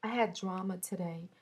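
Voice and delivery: usual speaking voice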